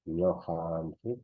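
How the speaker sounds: Ñojanchik spoken slowly with a rising end; teacher modelling Ñojanchik slowly; slow demo. Shown as a demonstration. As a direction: stretch out Ñojanchik for the class. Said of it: "no es ñojanchik".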